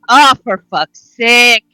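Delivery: fed-up groaned curse; exasperated huff. Acted. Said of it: "Yuki huffs and throws her hands".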